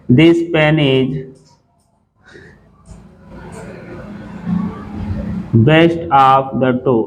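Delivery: very slow, word by word, reading "this pen is best of the two" aloud; dictated slowly. Shown as a demonstration. Teacher reads the exam sentence to students, very slow. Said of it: "यहाँ लिखा है".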